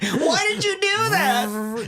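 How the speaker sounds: very high, slow wounded plea; hurt victim. Pretend. Stabbed victim whimpering after the selfie, high and slow. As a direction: drag it out, stunned and hurt. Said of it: "walk away like".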